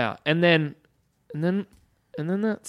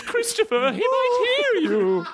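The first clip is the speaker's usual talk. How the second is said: put-on a fussy old lady clutching pearls. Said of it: "turned her into an old lady".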